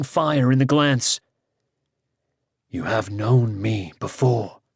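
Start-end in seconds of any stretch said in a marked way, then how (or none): 2.7-4.6 slow, lower, proud godlike tone; deep, proud voice. Acted. Kars as Rhiannon answers Owain, deep and proud. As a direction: speak with brooding majesty. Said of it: "the deep, proud voice".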